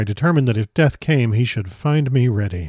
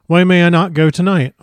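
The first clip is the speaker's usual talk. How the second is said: point blank; 6 semitones higher, 5 dB louder than usual. Acted. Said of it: "asked him point blank".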